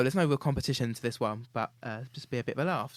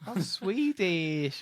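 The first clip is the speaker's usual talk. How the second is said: mock Swedish accent; 6 semitones higher, 4 dB louder, much slower than usual. Guessing game impression.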